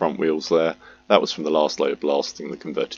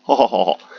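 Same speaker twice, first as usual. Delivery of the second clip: laugh; 5 semitones higher, 5 dB louder, faster than usual. Real, not acted. Laughing at his Red Dwarf joke.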